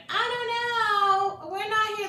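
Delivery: high, slow, dodging voice; hammed-up clueless worker; mock employee voice. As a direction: play dumb in a stretched high voice.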